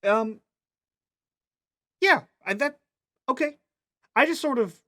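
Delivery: put-on very high, halting girl's voice; high-pitched, faltering little voice; hesitant child. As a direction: stumble through it as an unsure kid. Child answers hesitantly in character.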